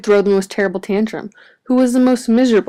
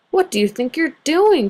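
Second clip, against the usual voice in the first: cry; 7 semitones higher than usual. A narrator's reading. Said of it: "cried Grouch".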